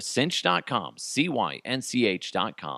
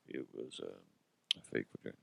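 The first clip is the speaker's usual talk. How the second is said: low, much softer, barely getting it out; embarrassed mumble; 14 semitones lower, 16 dB quieter, much faster than usual. Acted. Todd mumbles the embarrassing answer to the reporter, ashamed. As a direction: mumble it, hoping nobody hears.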